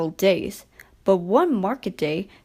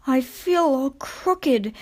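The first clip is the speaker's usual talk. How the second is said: slow, higher complaint; complaining; 5 semitones higher, slower than usual. A narrator's reading. Broken-down Skarloey complaining to his driver, slow. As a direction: moan that you feel crooked. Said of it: "he complained".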